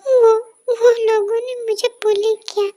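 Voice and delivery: stammering through tears; hurt boy admitting he was bullied; sobbing confession. Acted. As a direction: stutter it out while crying.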